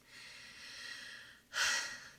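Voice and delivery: long, heavy breathy sigh; drawn-out sigh while searching for words. Real, not acted. Recalling a hard decision.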